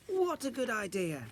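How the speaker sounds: high eager voice; excited. Acted. Isadora telling Pink Rabbit her wish, excited. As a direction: blurt it out with delight.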